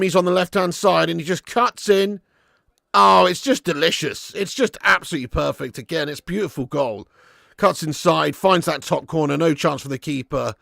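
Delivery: ordinary talk, nothing marked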